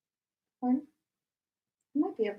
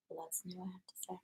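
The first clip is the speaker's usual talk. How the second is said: whisper; 2 semitones lower, 18 dB quieter, faster than usual. Real, not acted. Quiet aside.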